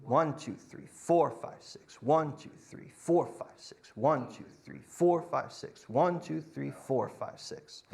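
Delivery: accent "One" and "four", let "five, six" fade to a breath; counting six with two pulses; compound feel. Shown as a demonstration. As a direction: stress on "One" and "four", trailing off. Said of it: "count it differently".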